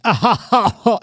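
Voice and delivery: high, camp comic actor's laugh; Paul Lynde. Retold or imitated. Showing how the actor played Templeton the rat, mock camp laugh. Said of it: "he was like".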